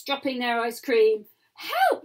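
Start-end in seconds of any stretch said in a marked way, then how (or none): 1.5-2.0 high yell; yelling for help. A narrator's reading. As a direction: shout help while running. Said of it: "yelled Jack".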